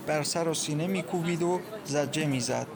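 ordinary delivery, nothing marked

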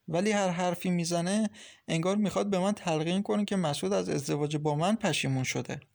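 ordinary delivery, nothing marked

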